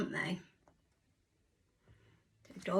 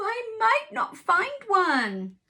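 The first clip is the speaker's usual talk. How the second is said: higher, slower, fretful rooster; a lightly voiced gloomy rooster; worried rooster; 8 semitones higher, 4 dB louder, slower than usual. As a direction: rooster fearing the worst. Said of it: "said the rooster".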